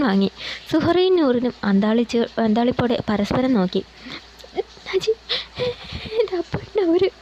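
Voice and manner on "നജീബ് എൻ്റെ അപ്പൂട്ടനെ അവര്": high, tearful broken cry; bursting into tears. A narrator's reading. Meenu cries to Najeeb about her fiancé, sobbing. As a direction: sob out his name, breaking down. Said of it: "അവൾ പൊട്ടിക്കരഞ്ഞു".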